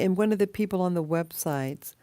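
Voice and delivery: normal talking voice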